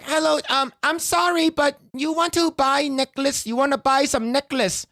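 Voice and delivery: high-pitched voice offering a necklace; high pushy seller. Retold or imitated. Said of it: "she's like".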